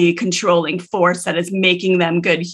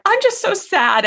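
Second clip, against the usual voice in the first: imitating a weeping child; 13 semitones higher than usual. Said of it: "as he's weeping".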